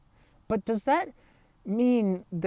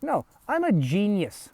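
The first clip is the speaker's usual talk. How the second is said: ordinary talk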